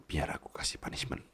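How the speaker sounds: soft whispered tease; hushed teasing threat. Acted.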